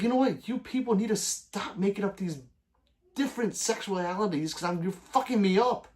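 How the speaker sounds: hammed-up exasperated complaint; mock rant. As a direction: playfully scold the friend. Retold rant at friend.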